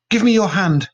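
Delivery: high loud desperate plea; crying out. A narrator's reading. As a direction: reach for her, shouting. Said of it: "cried Caroline".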